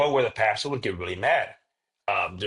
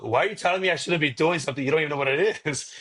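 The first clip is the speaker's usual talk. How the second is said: indignant retort; 5 semitones higher, faster than usual. Retold or imitated. Brother retorts to English pastor.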